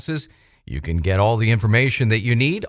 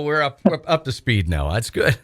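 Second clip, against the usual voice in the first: ordinary delivery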